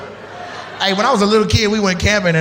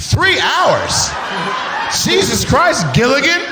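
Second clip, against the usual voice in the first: shocked disbelief; 8 semitones higher, slower than usual. Real, not acted. Shocked crowd-work reaction.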